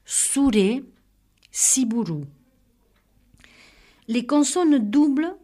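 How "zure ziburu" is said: "Souré, siburu" opening on a clear hissed s. Shown as a demonstration. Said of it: "se prononce comme le C cédille". (« Souré, siburu » : z prononcé comme ç)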